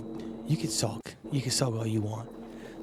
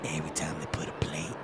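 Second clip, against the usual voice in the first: whispered threat, much lower; menacing whisper; 8 semitones lower, 6 dB quieter, faster than usual. Acted. Rat boss whispering a threat to the adventurer.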